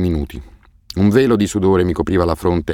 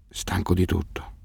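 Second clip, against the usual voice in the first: very soft, slow, exhausted murmur; veiled near-whisper; 8 dB quieter than usual. A narrator's reading. Weary boy answering his guardian, veiled near-whisper. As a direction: let it out slowly, almost without voice. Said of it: "La sua voce era velata, quasi un sussurro".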